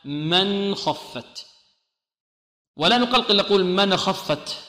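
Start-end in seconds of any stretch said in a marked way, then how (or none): 0.0-1.5 من خفت with the nun clear, a short ghunna, slowly; tajweed demo; correct izhar demo. Shown as a demonstration. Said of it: "بغنة ناقصة".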